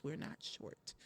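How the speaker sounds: a mock confidential whisper; whispered aside. Coach whispering a joke to her audience, whispered. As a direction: whisper it like a secret. Joking about being short.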